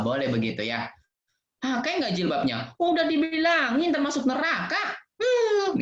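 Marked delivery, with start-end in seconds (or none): 1.6-5.0 high, hammed-up scolding, breaking into a laugh; mock angry scold. Shown as a demonstration. Teacher shows kids the wrong way to push a friend, mock angry scold. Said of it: "Nggak boleh marah-marah". 5.2-5.8 mock whimper. Mock whimper after scolding.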